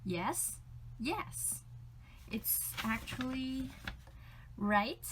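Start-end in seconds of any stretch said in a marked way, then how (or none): 1.0-1.6 yes pitched much higher, rising as a question. Shown as a demonstration. Teacher demonstrating yes as second tone. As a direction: lift yes up. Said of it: "yes is second tone".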